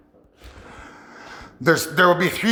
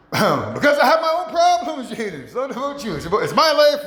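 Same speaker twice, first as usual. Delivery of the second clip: defensive retort; 6 semitones higher than usual. Retold or imitated. Answering the imagined ex.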